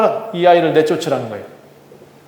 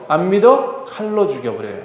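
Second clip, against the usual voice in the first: ordinary delivery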